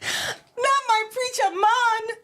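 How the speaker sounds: high, drawn-out laughing wail; laughing to tears. Real, not acted. Co-host disbelieving the preacher rhyme, laughing to tears. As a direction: wail it slowly, helpless with laughter. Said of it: "I'm literally tearing up".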